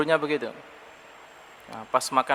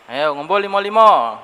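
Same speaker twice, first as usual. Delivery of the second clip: calling out; 3 semitones higher, 7 dB louder than usual. Retold or imitated. Adult calling the children together.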